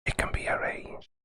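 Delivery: soft unvoiced ASMR whisper, close to the mic. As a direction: breathe the words in a teasing ASMR whisper. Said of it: "do some A S M R".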